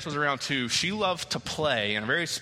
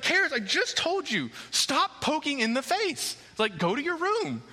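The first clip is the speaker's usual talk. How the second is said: mock scolding; 11 semitones higher than usual. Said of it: "I kinda scold her".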